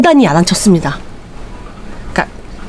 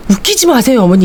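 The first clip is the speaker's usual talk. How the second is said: usual speaking voice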